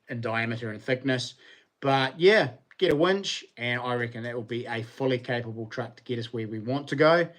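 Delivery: ordinary delivery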